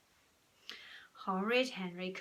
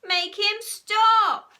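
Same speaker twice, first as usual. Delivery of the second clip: loud, high, drawn-out demand; squabbling child's wail; shout; 12 semitones higher, 14 dB louder, much slower than usual. A narrator's reading. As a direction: yell for a parent to step in. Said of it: "shouted Henry and Peter".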